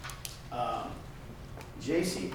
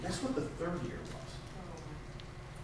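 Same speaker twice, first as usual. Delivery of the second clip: teasing question; 11 dB quieter, much slower than usual. Real, not acted. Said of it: "teasing the audience".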